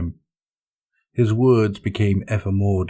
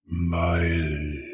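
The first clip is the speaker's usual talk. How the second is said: growling; 3 semitones lower, 4 dB quieter than usual. Acted. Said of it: "Typhus growled".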